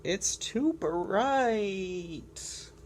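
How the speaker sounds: very slow drawn-out whine; complaining penguin. Acted. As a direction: drag out the moan. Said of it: "Doing my complaining voice hurts".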